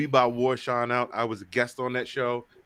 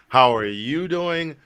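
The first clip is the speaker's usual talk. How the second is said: drawn-out greeting; 3 semitones higher, 6 dB louder, slower than usual. Shown as a demonstration. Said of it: "repeated greeting, drawn out".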